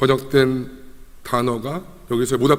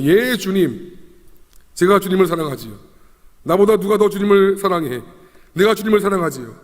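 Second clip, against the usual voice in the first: high, eager declaration; unhesitating confidence; 5 semitones higher, 3 dB louder than usual. Acted. The old Peter boasts of his love to Jesus, confidently. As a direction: answer at once, sure of yourself. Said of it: "이전 같은 베드로의 모습이었다면 고민도 안 했겠죠".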